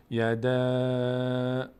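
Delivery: "یده" with the last vowel held very long and level; elongated madd. Shown as a demonstration. Teacher demonstrating madd in practice, long held vowel. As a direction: stretch the thin ending of "یده" steadily.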